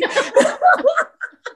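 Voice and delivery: very high laughing burst; high cackle. Real, not acted. Host laughing at being treated like a king in Tokyo, in a high cackle.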